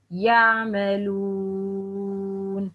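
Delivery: slow يعملون with a smooth, long oo; unstressed dhamma. Shown as a demonstration. Teacher models waw mad, dhamma not over-stressed. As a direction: glide into the held oo of يعملون without punching it. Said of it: "not stress strongly on the dhamma".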